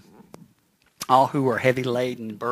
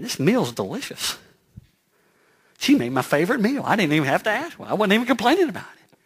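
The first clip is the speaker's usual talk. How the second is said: high, wondering husband voice, pitched up; mock-bewildered husband, higher and louder; puzzled husband; 8 semitones higher, 4 dB louder than usual. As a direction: play the baffled husband noticing his wife.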